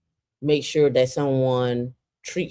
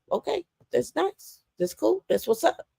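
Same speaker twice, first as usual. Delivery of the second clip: high, softer feigned approval; fake polite; 14 semitones higher, 5 dB quieter than usual. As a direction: humor them in a high sweet voice. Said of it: "I'd be like".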